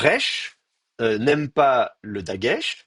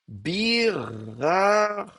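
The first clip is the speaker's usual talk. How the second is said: very slow, higher, stretched sounds; drawn-out syllables; 8 semitones higher, much slower than usual. Shown as a demonstration. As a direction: stretch each syllable right out. Sounds out hypothetical form while writing.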